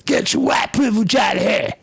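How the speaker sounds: high, mocking yell of an angry accuser; mock outburst. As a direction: shriek it like a caricature. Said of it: "it comes out of their mouth".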